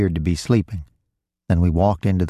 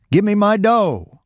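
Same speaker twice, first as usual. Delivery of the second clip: high, drawn-out angry yell; yelled demand; 13 semitones higher, 3 dB louder than usual. A narrator's reading. Robbed man demands his dough from Roy, yelling. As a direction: yell it slowly, pitched far up. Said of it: "he yelled".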